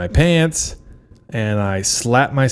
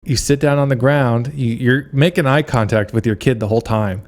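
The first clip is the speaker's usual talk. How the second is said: usual speaking voice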